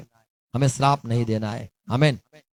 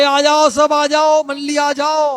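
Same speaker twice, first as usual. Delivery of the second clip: high, loud call to gather the crowd; 13 semitones higher, 4 dB louder, slower than usual. Acted. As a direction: summon everyone, slowly and loud. Moses calling the crowd together.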